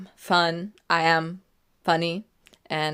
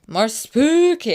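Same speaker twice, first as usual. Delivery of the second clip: playful spooky; 9 semitones higher, 9 dB louder, slower than usual. Pretend.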